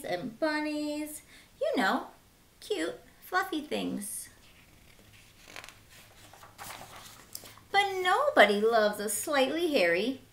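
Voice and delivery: put-on bright, lively read-aloud for kids; lively children's storyteller; storybook narration. Reading picture book to children.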